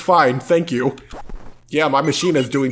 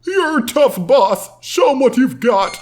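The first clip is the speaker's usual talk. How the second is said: high sidekick voice; 9 semitones higher than usual. Acted.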